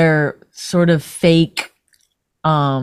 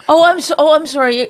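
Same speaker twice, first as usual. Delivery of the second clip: mock apology; 7 semitones higher than usual.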